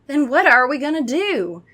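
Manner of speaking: high, loud worried question; shrill, high demand. A narrator's reading. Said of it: "Kara asked shrilly".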